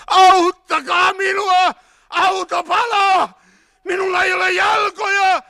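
high, loud, drawn-out desperate screams; screaming for help. A narrator's reading. Trapped wounded man in the burning car, screaming for help. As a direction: scream in agony, stretching the words. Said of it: "miten viimeinen henki jääneistä huusi apua".

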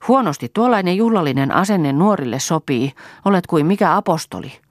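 mock her solemnity, lightly; teasing. A narrator's reading. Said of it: "ärsytteli Toini".

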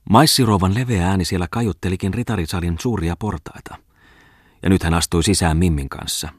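usual speaking voice

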